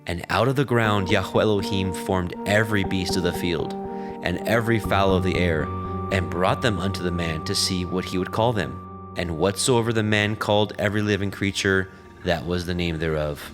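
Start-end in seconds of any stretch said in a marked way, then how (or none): none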